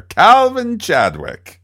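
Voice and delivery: grand, slow Mid-Atlantic accent, high and loud; old Hollywood Mid-Atlantic drawl. Shown as a demonstration. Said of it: "the Mid-Atlantic, uh, accent there".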